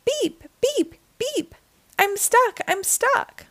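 high, small voice; tiny truck pleading for help; high-pitched plaintive toots and cries; distressed little truck. A narrator's reading. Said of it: "cried the little blue truck".

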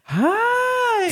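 squeaky high greeting; dog voice. Shown as a demonstration. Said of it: "what is your dog voice".

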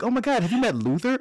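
high, quick, excited imitation; eager introducer. Imitating someone introducing the fake-named guest around, high excited chatter. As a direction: gush it quickly as the new acquaintance.

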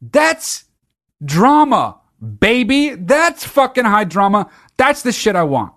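high, loud and delighted; hyped-up praise; gushing excitement. Real, not acted. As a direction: rave about it like a superfan. Gushing over the movie.

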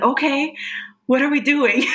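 excited shout to the ancestors; eager excitement. Retold or imitated. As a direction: call back eagerly, thrilled to join in. Answering ancestors in the dream.

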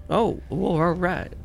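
a hint of a reluctant NPC. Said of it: "he goes".

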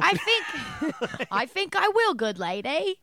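high, loud put-on Cockney; mock Cockney accent. Host playing British to the co-hosts, mock Cockney accent. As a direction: play a silly Cockney gent. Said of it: "It's a Cockney accent".

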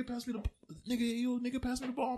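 high-pitched call for the ball; imitated calling out. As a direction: call across the court in a mimicking voice.

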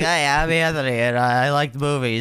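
nasal stuffy imitation. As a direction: mimic a blocked-nose friend. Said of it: "You're like".

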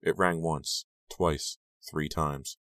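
ordinary delivery, nothing marked

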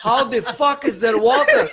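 high, loud, outraged disbelief; hammed-up bewildered neighbor shouting; baffled Armenian neighbor. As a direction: yell up like a furious old man who can't work it out. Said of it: "he heard the water".

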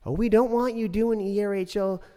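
high mocking prissy imitation; high sneering parody of the council lady; mocking Karen. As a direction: mimic her in a high, snooty voice. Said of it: "she said".

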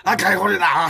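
high, loud pachinko shout; re-enacted excited yell. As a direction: yell it like the jackpot is coming. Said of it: "叫びすぎた結果". (興奮して叫ぶ再現)

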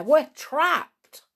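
high, loud cry of despair; a frightened uncle, high and loud. Acted. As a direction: wail that they're trapped.

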